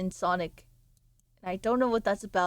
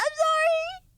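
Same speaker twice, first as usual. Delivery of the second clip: squeaky apology. Acted. Streamer squeaks an apology to a viewer. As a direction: yelp a quick cartoon sorry.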